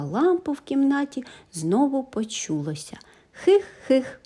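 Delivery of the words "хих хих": high sneaky giggle; a hidden creature's hee-hee. A narrator's reading. Dragons giggling in the dark room.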